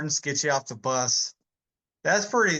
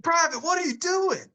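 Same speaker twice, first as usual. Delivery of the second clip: high-pitched mock drill sergeant challenge; mock yell without the volume; imitated drill sergeant. Retold drill sergeant confronting meditating private.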